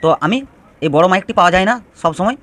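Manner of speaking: ordinary delivery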